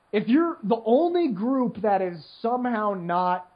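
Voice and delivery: usual speaking voice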